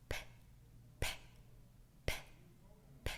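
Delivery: repeated p, each a quick burst from closed lips; popped p sounds. Shown as a demonstration. Teacher demonstrating lip pops to children, quick bursts. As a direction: pop it, pop it, pop it. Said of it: "breathe out quickly and pop our lips open".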